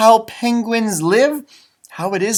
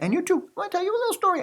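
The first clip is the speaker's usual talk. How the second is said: high, fast voice for the toy penguin; high, fast baby-talk to the toy; 11 semitones higher, 7 dB quieter, much faster than usual. Acted. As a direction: chatter to the little toy like a baby.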